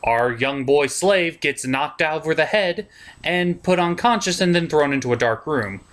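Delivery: normal talking voice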